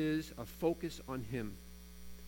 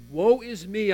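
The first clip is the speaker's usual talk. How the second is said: a raised, higher cry of woe; Isaiah's cry; 5 semitones higher, 8 dB louder, slower than usual. A narrator's reading. Isaiah crying out before God, raised cry. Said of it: "I cried".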